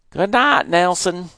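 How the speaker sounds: high, loud parting call; yelled good night. Acted. Father calling good night to neighbour. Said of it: "Daddy yelled back".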